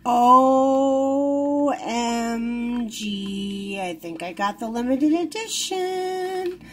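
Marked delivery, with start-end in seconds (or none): none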